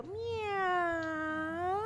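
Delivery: high, long drawn-out meow; cat meow. Shown as a demonstration. Yoga teacher shows kids the cat sound, high drawn-out meow. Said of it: "For cat, you're gonna go".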